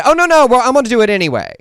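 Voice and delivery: high, loud mocking protest; mock defiant governor. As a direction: burst in high and loud, mimicking a stubborn politician. Voicing governor's defiant refusal in retelling.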